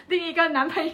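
sheepish laughing confession. Real, not acted. Telling the hosts about her rebound, laughing.

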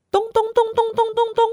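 quick, high mock thumping sound; high thumping noise. Storyteller to children, imitating thumping noises, high and quick. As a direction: tap out the thumps fast and high.

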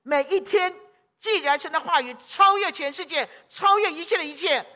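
rising final charge; preaching exhortation. Real, not acted. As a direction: drive it home to the flock. Closing exhortation.

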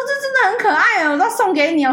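high-pitched imitated gift-giver; mock gushing. Colleague hands over a cheap souvenir pen, mock gushing.

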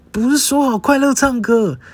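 high, slowed, loud protest; half-comic exasperated complaint; exasperated whine. Retold or imitated. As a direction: whine it like you've been tricked. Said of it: "我想说".

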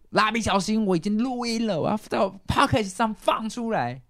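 音调高很多，最后一句放慢; 一字一句宣布给他听; 得意的警告. Retold or imitated. 当面警告叔叔. (对叔叔高声宣布已经录音)

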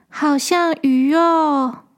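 put-on slow, dreamy little girl murmur. A narrator's reading. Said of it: "自己自言自语地说".

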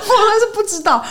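high squealing laugh into the words. Real, not acted. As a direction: laugh helplessly, then speak. Laughing over police collusion claim.

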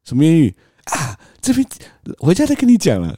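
husband startled by news of a flirtation on the phone; shocked. Retold or imitated. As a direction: shoot it up high in shock, then brush off.

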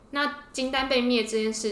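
ordinary talk, nothing marked

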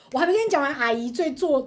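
ordinary talk, nothing marked

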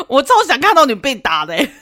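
loud, high laughing jeer; gleeful mockery. Real, not acted. Host laughing loudly that the boyfriend deserved a beating. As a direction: relish wishing he got hit.

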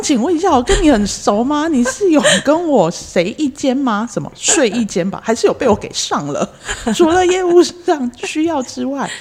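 loud, high indignant tirade; indignant rant. Acted. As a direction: scold her, building outrage.

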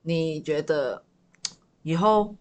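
ordinary talk, nothing marked

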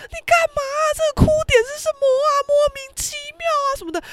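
very high-pitched, baffled squeal; imitated shocked friends. Friends startled by sudden crying, shrieking bafflement. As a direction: squeak in alarmed bewilderment. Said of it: "现场的大家全部都吓死".

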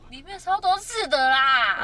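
loud, drawn-out complaint; exasperated exclaim. Real, not acted. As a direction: stretch it out in exasperation. Complaining about fish bones.

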